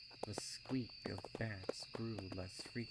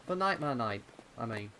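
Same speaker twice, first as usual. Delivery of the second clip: put-on young colt voice; 3 semitones higher, 9 dB louder, faster than usual. Pip clarifying in character.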